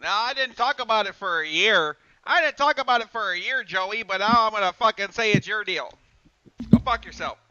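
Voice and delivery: loud, high angry rant. Retold or imitated. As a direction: tell him off, building up. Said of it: "He wanted to pull that".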